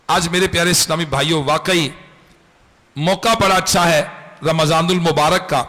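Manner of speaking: ordinary delivery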